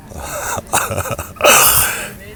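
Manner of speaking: breathy laugh at a slip; embarrassed laugh. Real, not acted. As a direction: laugh it off, caught out. Laughs after slipping pin.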